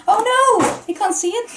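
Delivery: high, loud playful cry of dismay; amused alarm. Real, not acted. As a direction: exclaim high and loud, about to laugh. Reacts to cat playing with pin.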